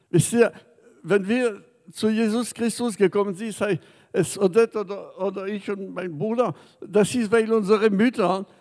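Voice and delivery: ordinary talk, nothing marked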